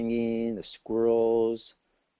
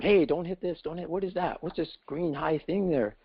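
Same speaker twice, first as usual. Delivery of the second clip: half-hearted yelp in a raised pitch; mild mock scream; 7 semitones higher than usual. A narrator's reading. Frog out of the well screaming at the bird, mildly. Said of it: "he was screaming at the bird".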